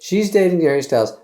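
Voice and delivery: loud gossipy reveal; excited. Acted.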